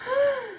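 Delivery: a quick high startled gasp; sharp gasp. Acted. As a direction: suck in air, surprised. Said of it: "Thomas gasped".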